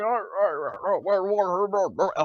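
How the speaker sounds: mock mumbling stammer. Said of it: "it's like".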